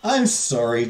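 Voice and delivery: ordinary delivery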